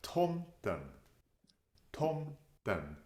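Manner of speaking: ordinary delivery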